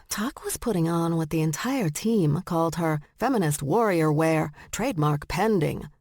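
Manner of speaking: plain reading voice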